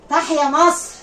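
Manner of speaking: a high, loud patriotic cheer; cheering shout. Acted. Influencer cheering her own bravery, loud chant.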